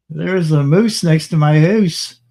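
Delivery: hammed-up Canadian drawl; mock Canadian accent. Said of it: "She don't have no house".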